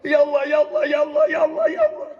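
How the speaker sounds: rising repeated plea that breaks into a high shout on the last call; crying out to God. Retold or imitated. Preacher shows the congregation how to cry out to God, ending in a high shout. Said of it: "nangis dan berteriak dan berzikir".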